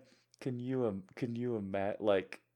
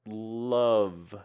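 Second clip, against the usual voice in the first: ordinary talk, nothing marked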